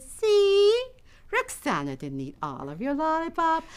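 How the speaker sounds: high, slow, pointed coaxing; lightly acted mother making her point; approving mom. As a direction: show the older sister how kind this is. Mother praises the sharing.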